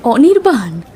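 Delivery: saying his name through a happy laugh; delighted laugh. Acted. Old lover spots him after years, laughing his name.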